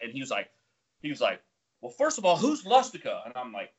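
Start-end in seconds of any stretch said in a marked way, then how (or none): 1.8-3.3 high, loud retold challenge; mock instructor demand. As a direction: call out the culprit like an instructor. Instructor confronts the recruits in retold quote.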